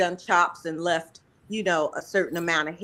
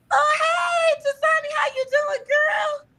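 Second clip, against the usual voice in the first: high, loud squealing hello to a friend; excited greeting; 3 dB louder than usual. Real, not acted. Host calling out to her friend Dasani as she joins, in a high, loud squeal. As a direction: shriek her name with delight like you haven't seen her in ages.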